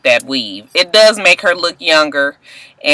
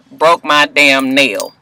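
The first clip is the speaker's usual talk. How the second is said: usual speaking voice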